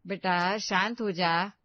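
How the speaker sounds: ordinary delivery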